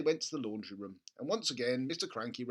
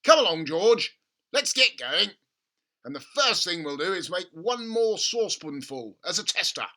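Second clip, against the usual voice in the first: much higher, much louder excited dad; gushing over a money-making idea; excited father; 7 semitones higher, 12 dB louder than usual. A narrator's reading. Father urging his son at breakfast, excited. Mr Cranky voiced.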